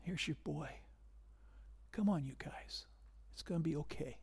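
soft whisper, tender; whispered reassurance. Retold or imitated. Jesus handing the son to his mother, whispered. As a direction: coax her softly over. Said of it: "And then he says".

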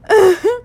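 high, loud chuckle; short laugh. Real, not acted. Amused after venting to God, high short laugh. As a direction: break into a quick high laugh.

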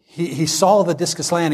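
ordinary talk

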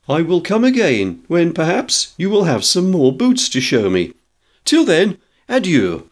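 high, formal customer bidding goodbye; stilted phrasebook adieu in a high voice; courteous farewell. A narrator's reading. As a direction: take lofty, polite leave, pitched well up. George's lofty farewell to furious shopkeeper.

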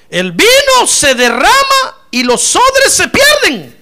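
versículo fuerte, agudo y lento; proclamación bíblica. Acted. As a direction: proclamar alargando cada frase. (lectura de predicador a plena voz)

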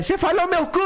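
high furious yell, laughing; angry chef shout. Retold or imitated. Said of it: "O cara fala".

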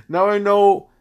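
high giggly Now I know; stumbling, giggling retry; laughing. Real, not acted. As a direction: laugh through the words. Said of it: "I can't even speak right now".

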